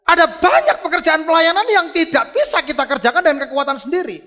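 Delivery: loud, high-pitched preacher's cry; high, ringing sermon climax; full-force preaching. Real, not acted. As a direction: proclaim it forcefully to the congregation.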